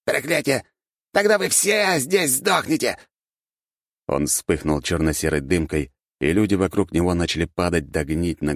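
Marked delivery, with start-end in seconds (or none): 0.0-3.0 rat man. A narrator's reading. Rat man curses everyone.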